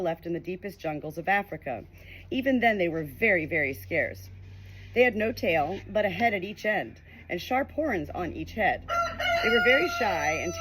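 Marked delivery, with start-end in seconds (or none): none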